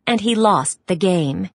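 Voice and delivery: usual speaking voice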